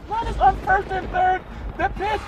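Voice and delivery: very high falsetto call building to a shout. Pretend. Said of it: "one more [name] impersonation".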